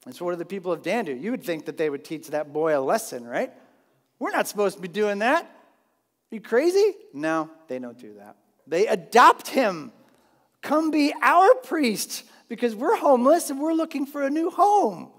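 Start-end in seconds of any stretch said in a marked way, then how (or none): 4.2-6.9 mock scolding. Danites scolding the priest, mock outrage. 10.6-15.1 much higher, louder begging voice; mock pleading invitation. Danites begging the Levite to be their priest, hammed-up plea.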